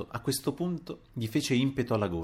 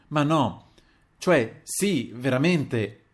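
higher, louder halting self-correction; flustered stammering; 5 semitones higher, 5 dB louder, much slower than usual. A narrator's reading. Old father answers the apology, stammering in confusion. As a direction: stumble from one word to the next. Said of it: "balbettò il Paleari".

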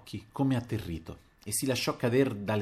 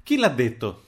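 louder, indignant demand; cried out; 3 semitones higher, 6 dB louder than usual. A narrator's reading. Narrator challenges the landlady in anger, crying out. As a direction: fire the question out crossly. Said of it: "gridai alla caporale".

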